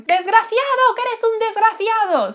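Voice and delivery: put-on shrill, high, loud old lady screaming at a thief. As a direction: scream after the purse thief like an outraged old Spanish lady. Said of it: "then you would probably scream".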